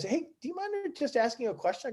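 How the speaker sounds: high, soft imitated request; tentative asking. Player approaching him with a question, tentative. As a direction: ask softly, as if seeking permission.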